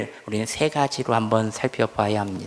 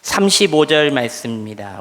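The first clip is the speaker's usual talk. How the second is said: ordinary talk, nothing marked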